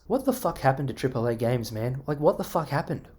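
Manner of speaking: ordinary talk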